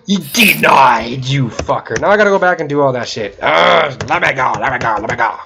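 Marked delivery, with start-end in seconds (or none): none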